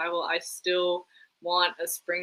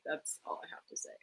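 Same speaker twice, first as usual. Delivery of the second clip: breathy whispered aside, much softer; 16 dB quieter, faster than usual. Real, not acted. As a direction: drop to a whisper for the last word on it. Muttered closing verdict on the season.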